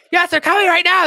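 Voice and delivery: very high, loud cartoon yell; urgent insistence. Kenny warning Cartman, high urgent mock yell. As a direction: hammer it home in mock alarm.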